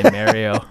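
"Mario" starting like "mare"; mispronounced Mario. Retold or imitated. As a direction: say "Mario" with the vowel of "Mary". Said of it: "sounds like a guy who would say".

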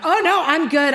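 passive-aggressive wife; mock-cheerful refusal. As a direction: insist you are fine when you are not. Acted sarcastic refusal to husband.

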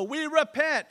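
high, loud cry of a whole city repenting; crowd repenting. Acted. Gentile city answering Jonah, loud high mock cry. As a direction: call it out like the city giving in.

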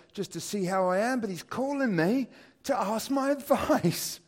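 bursting with delight at being asked; super excited. Retold or imitated. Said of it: "So I'm super excited".

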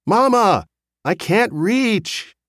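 high, loud whine, stretched out slowly; little child's protesting squawk; squawking toddler. A narrator's reading. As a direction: squawk it like a three-year-old wanting Mama.

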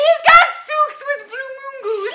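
"souks" and "moon goose" shouted high, vowels as ooh; ooh language. Shown as a demonstration. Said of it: "That means socks with flamingos".

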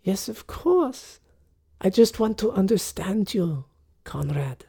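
a lightly voiced elegant widow; genteel lady. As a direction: invite him to sit, politely. Charisse speaks.

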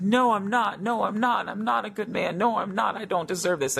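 imitating a man crying, pitched high; tearful protest. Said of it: "he just started crying".

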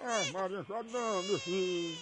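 very soft, murmured soothing; hushing a baby. Pretend. Caller turns from the phone to hush the baby, very soft. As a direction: barely voice it, comforting the baby.

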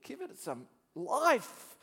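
slow, high, swelling to loud; rousing self-exhortation; urging himself. Retold or imitated. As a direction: stretch it out and burst on the last word. Scolding himself to pray with passion.

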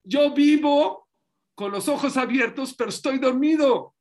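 mucho más alto y agudo, clamando; confesión clamada. Acted. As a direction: clama como quien confiesa a Dios. Habla como el pueblo a Dios. (el pueblo confiesa a Dios, clamando en alto)

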